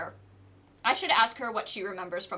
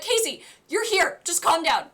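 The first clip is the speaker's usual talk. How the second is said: urgent calming; 6 semitones higher, 5 dB louder than usual. Retold or imitated. Retells calming panicking friend.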